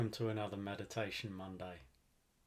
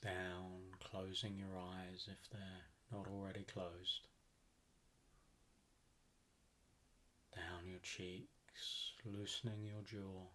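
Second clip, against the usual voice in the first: guided meditation; 8 dB quieter than usual. Acted. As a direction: ease them down into relaxation, unhurried and even.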